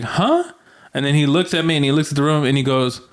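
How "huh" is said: sharp high confused huh; a baffled yelp at the door. Retold or imitated. To the stranger, a high startled huh. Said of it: "And I'm like".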